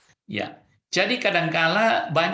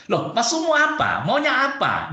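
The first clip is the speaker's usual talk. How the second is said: higher, louder mock confrontation; challenging; 8 semitones higher, 4 dB louder than usual. Shown as a demonstration. Showing how a spouse snaps back, mock challenging. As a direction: push back as if picking a fight. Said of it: "Seakan-akan menantang".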